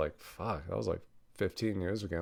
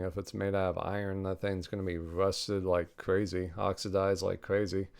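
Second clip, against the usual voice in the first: normal talking voice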